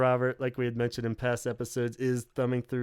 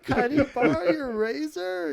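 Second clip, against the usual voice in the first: high, slow imitation of the makeup lady; 14 semitones higher than usual. Makeup lady asks Perry about a razor, in a high slow imitation. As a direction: mimic her asking politely, pitched way up. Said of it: "She's like".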